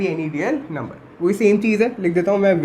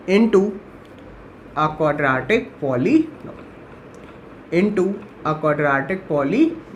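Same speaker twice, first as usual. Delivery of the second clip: drawn-out, lower-pitched words in separate chunks; slow dictation; 4 semitones lower, much slower than usual. Real, not acted. Teacher finishing a note for students to copy, slow dictation. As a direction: dictate very slowly, repeating for the writers.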